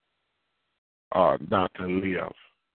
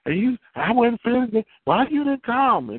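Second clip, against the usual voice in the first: much higher, complaining voice; whiny grown child; 13 semitones higher than usual. Retold or imitated. Grown child complaining to mama, high and whiny. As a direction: pout it at mama.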